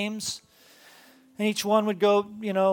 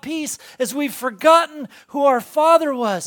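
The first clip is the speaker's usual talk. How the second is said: louder and higher, building sermon fervor; preaching at full force; 5 semitones higher, 6 dB louder than usual. Acted. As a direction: preach it with rising conviction. Preaching climax about God.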